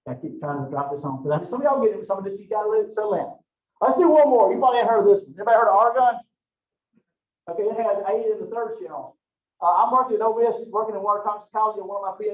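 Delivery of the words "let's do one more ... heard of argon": rallying the class. Real, not acted.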